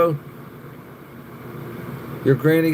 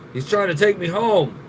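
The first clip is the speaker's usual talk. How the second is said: usual speaking voice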